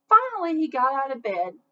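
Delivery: ordinary delivery, nothing marked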